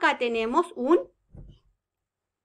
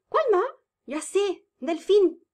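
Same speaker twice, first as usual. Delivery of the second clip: high and louder, a playful gasp first; mock excited guess; 7 semitones higher, 4 dB louder than usual. Teacher faking a wrong guess to test the children, excited. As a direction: pretend to have a bright idea.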